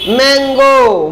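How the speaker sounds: मैंगो stretched out very slowly; slow teaching model of मैंगो; demonstrated pronunciation. Shown as a demonstration. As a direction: draw out मैंगो from its opening m. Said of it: "मौ से उच्चारण कर रहे हैं".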